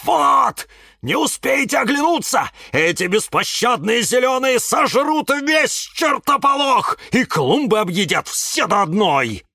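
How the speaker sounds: high-pitched, alarmed ranting; Кирилл yelling. A narrator's reading. Cactus warning that the green eaters will devour everything, yelling. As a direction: cry out the dire warning. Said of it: "продолжал вопить кактус Кирилл".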